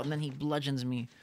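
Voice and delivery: normal talking voice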